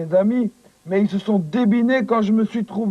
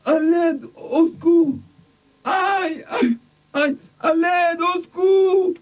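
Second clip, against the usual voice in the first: calling for help; 8 semitones higher, slower than usual. Acted. Coco calling for help in pain.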